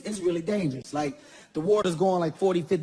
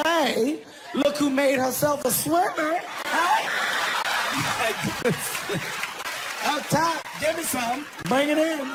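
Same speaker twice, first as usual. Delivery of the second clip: high, overly bright congratulation; awkward cheerful greeting; 8 semitones higher, slower than usual. Retold or imitated. Husband greeting his wife after leaving her, high forced cheer. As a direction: cover the awkwardness with fake cheer. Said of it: "I was like".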